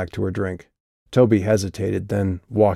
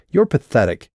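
higher, contemptuous sneer; 5 semitones higher, 3 dB louder than usual. A narrator's reading. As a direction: load it with scorn. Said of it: "Calliope filled her voice with the sneer".

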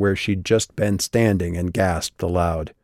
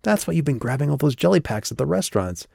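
quick, higher-pitched deduction; high, hurried discovery; excited realization; 6 semitones higher, faster than usual. A narrator's reading. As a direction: rush it out as it dawns on you. Calliope excitedly works it out.

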